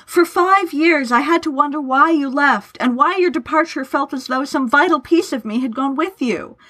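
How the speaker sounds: high-pitched, impassioned anguish; trembling outcry. A narrator's reading. Laurence pours out five years of missing his friend, anguished outcry. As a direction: pour it out, pitch held high. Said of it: "cried, a tremble in his voice".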